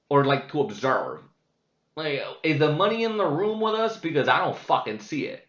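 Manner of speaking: loud, high exasperated outburst at a patient; retold rant. Venting at the old patient about money, loud incredulous rant. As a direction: blow up in mock disbelief, voice climbing.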